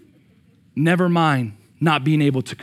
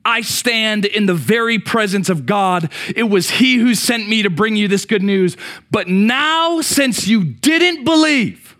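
booming, higher and louder angel's rebuke; angel Gabriel; 9 semitones higher, 5 dB louder, slower than usual. Acted. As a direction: announce like a messenger of God. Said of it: "Then the angel said".

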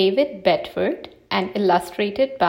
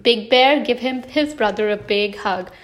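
usual speaking voice

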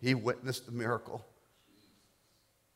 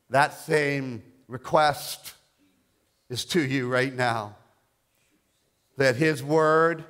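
forceful preaching; 6 semitones higher, 8 dB louder than usual. Real, not acted. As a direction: declare it loudly, phrase by phrase, with weight.

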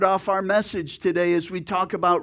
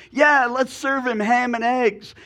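higher and louder, thrilled pig; excited pig; 7 semitones higher, 5 dB louder than usual. Acted. Pig agreeing to ham and eggs, thrilled. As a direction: blurt it out with delight. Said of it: "He's all excited".

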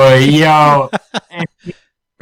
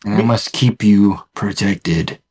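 lower, slow, heavy possessive voice; mock Kingpin; 7 semitones lower, slower than usual. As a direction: play a looming, overbearing husband. Voicing Fisk possessively.

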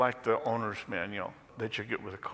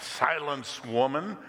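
mildly raised, commanding cry; mild outcry; 5 semitones higher, 5 dB louder than usual. A narrator's reading. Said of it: "cried a cave dweller".

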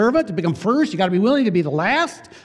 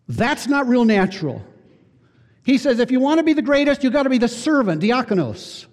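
fervent preaching; 6 semitones higher than usual. Acted. Pastor preaching on greatness, fervent and raised. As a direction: drive the point home to the congregation.

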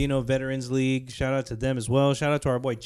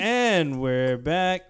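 slow announcer; 4 semitones higher, much slower than usual. Shown as a demonstration. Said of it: "second take of intro".

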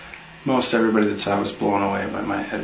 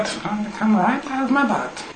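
squeaky dog voice; 11 semitones higher than usual. Pretend. Answers in the dog voice.

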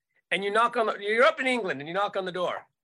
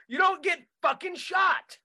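ordinary talk, nothing marked

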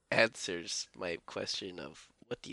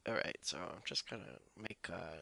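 George Lucas impression; 7 dB quieter than usual. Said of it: "same man who's just like".

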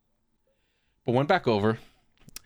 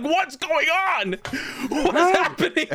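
high, slow incredulous wail; mock-stunned disbelief; bewildered. Patient baffled by the old lady, high slow bewildered wail. Speaker's own bewildered reaction.

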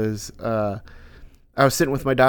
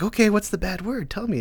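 put-on dad's kid-talk voice; 8 semitones higher than usual. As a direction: go up high and gentle for a small child. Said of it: "I have, like, this octave that I go up".

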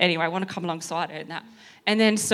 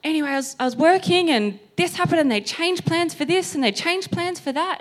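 blurted-out account; 9 semitones higher than usual. Retold or imitated. Client blurting out work troubles to her trainer, high-pitched venting. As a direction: imitate a higher-pitched outpouring. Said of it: "she blurted out".

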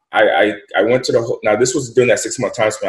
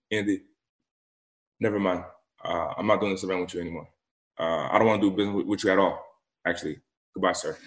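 much softer, flat cold brush-off; cold dismissal; 3 semitones lower, 10 dB quieter, faster than usual. Retold or imitated. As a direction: shut him down quietly and coldly. Les coldly cancels the event.

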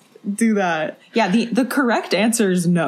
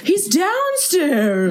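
put-on raised, slow, drawn-out old-timey call; mock Mike as a man from the twenties; old-timey 1920s voice; 10 semitones higher, much slower than usual. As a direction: say it like a ghost from nineteen twenty. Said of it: "why do you make him sound like he's from nineteen twenty".

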